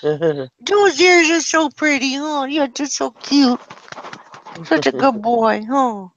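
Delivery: slow high doting coo. Real, not acted. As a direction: dote on him slowly and sweetly. Cooing at the puppy.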